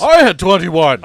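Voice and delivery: loud, drawn-out mock boast; mock boastful shout. Player trumpeting an initiative roll, loud mock boast. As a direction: belt it out slowly as a brag.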